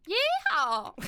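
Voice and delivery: high, loud mock country holler; exaggerated country accent cheer. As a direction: whoop it like a cartoon country girl. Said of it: "like, a English country accent".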